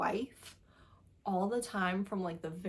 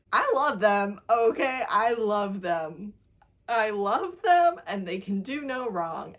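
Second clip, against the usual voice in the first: loud, laughing declaration of love; laughing, emphatic fan gush; 7 dB louder, slower than usual. Real, not acted. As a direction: burst out adoring them. Gushing over the characters.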